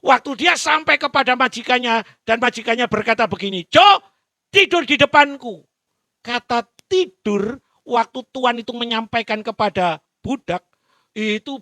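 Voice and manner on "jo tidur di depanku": high, loud command; master's loud order. Acted. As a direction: order him to lie down. (perintah keras majikan)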